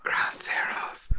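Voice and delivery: slow, soft whisper; mimicked creepy whisper. Host echoing his co-host's silly voice, creepy whisper. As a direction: copy the creepy voice in a slow hush.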